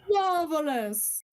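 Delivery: high, slow catchphrase; exaggerated "marvelous" impression; Lee. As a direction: draw it out from high to a falling end. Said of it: "now it's Marvelous".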